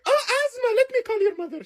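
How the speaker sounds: very high-pitched nurse impression; put-on high Russian nurse voice. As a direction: squeal it like a fussing nurse. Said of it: "I had a Russian nurse".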